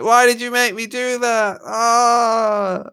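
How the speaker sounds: hammed-up wounded howl, high; mock anguished scream. Mock soldier shot on the floor, hammed-up anguished high howl. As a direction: cry out like a shot soldier, overplayed. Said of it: "you're there screaming on the floor".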